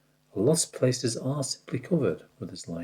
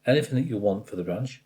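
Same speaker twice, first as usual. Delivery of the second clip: normal talking voice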